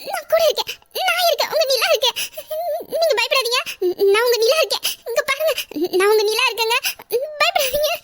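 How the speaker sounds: high, urgent comforting on the edge of tears; tearful reassurance. Acted. Wife reassures her frightened husband, high tearful comfort. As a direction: hold him and promise you're here.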